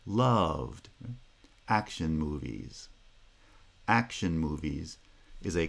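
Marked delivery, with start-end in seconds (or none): 0.1-0.9 "Loved" with pitch falling across one syllable. Shown as a demonstration. Teacher showing the fall on the single syllable "Loved". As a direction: glide down within "Loved". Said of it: "falling pitch happens across ... a single syllable".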